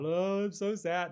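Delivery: slow, drawn-out hammed-up whimper; mock bawling. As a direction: overplay a weepy lament to mock it. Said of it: "bawling his eyes out".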